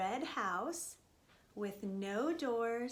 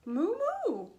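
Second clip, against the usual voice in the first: high, loud double moo; cows mooing; 8 semitones higher, 7 dB louder than usual. Acted. Cows answer mouse's request, high loud mooing. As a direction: moo back like a cow. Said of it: "said the cows".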